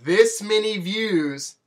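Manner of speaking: very slow, deliberate; drawn-out. Real, not acted. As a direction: stretch it out while showing the number. Gesturing view count.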